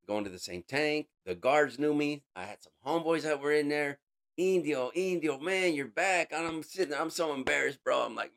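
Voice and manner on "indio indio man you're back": higher, louder calling out a buddy's name; mock friendly greeting. Homeboys in the tank greet him, loud and teasing. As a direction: hail an old friend who's back.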